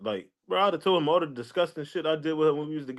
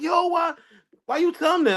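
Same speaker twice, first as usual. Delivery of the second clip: imitating an upset friend; 13 semitones higher than usual. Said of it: "She was like".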